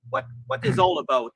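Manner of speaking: ordinary talk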